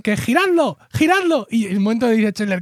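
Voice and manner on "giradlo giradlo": shouting. Retold or imitated. Acting the dubbed pivot line.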